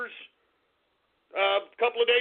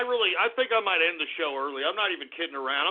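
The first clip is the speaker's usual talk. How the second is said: ordinary talk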